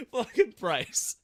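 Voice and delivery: high, slow, laughing curse at a friend; laughing exasperation. Real, not acted. Laughing at his friend's crash, slow and high. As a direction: groan his name through laughter.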